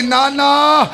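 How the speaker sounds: high, slow wailing lament; a granddaughter wails at the grave; weeping lament. Acted. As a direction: wail the loss to the grandfather. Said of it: "बीबी रो के कहती".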